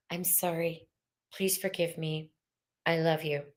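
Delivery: hushed, gentle Ho'oponopono prayer; quiet healing prayer; soft prayer. Shown as a demonstration. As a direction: say it softly, like a prayer to your own heart.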